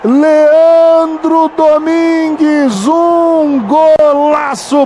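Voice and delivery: names drawn out slow and loud; stretched goal call. Real, not acted. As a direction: stretch every syllable in triumph. Stretched scorer call after goal. (narração de gol arrastada)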